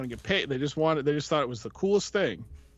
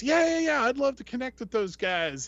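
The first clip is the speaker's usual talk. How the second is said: excited; 9 semitones higher than usual. Retold or imitated. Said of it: "And he goes".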